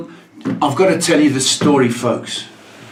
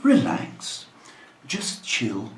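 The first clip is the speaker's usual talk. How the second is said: mock soothing; 7 dB quieter, slower than usual. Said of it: "somebody saying".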